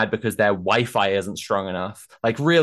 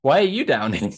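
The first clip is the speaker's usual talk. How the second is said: laughing while throwing the question back; laughing retort; 3 semitones higher than usual. Retold or imitated. Protesters fire the reporter's question back at him, laughing. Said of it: "we're just like".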